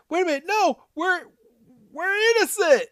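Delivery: very high, pleading cartoon voice; mock panic. Podcaster voicing Team Rocket facing bad Morpeko, mock panic. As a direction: plead innocence in a squeaky put-on pitch.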